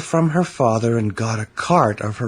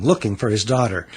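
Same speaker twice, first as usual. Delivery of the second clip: ordinary delivery, nothing marked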